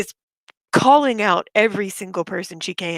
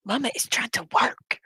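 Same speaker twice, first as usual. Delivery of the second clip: hushed whisper to a pet; 4 semitones higher, 4 dB quieter than usual. Real, not acted. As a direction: softly shush the cat. Whispering to her cat.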